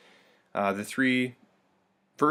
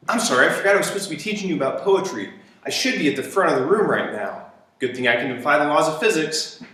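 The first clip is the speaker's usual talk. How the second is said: hurried teacher; 4 semitones higher, 5 dB louder, faster than usual. Pretend. Teacher pops in, joking.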